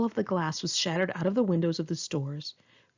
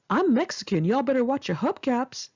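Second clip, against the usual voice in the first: put-on joking drunk friend; 7 semitones higher than usual. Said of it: "he said, cackling".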